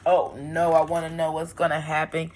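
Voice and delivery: ordinary delivery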